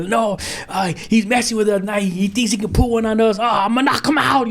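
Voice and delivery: hammed-up angry dad; retold anger. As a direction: replay his own hot-headed rant. Retelling his own angry reply.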